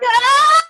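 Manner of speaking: scared man screaming as he runs off; high scream. Acted. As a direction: let out a high panicked shriek. Eric flees like scared chicken.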